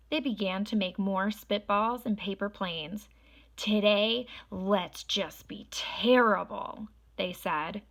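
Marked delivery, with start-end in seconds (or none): 3.6-6.9 loud, slow, gleeful mischief; gleeful kids. Acted. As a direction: drag it out like naughty kids plotting. Unruly kids planning mischief.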